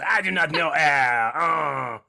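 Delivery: put-on over-the-top French pirate captain accent; bad French pirate accent. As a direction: bellow it in a mock French accent. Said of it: "that bad French captain pirate thing".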